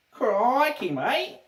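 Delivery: hammed-up Australian accent, pitched high. Streamer hamming up an Australian catchphrase, high Australian accent. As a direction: squeal it like a cartoon Aussie. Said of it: "Australian accent".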